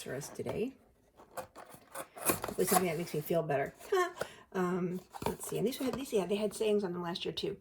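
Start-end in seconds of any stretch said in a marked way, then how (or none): none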